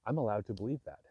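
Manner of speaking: ordinary delivery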